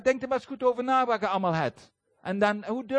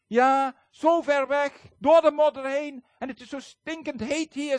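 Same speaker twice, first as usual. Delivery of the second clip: loud, slow, high-pitched moaning; whiny complaining; 6 semitones higher, 6 dB louder than usual. Retold or imitated. Grumbling pastor complains to Ronnie about the mud, loud high moaning. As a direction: drag out his grumbling in a loud high moan. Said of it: "En hij maar klagen".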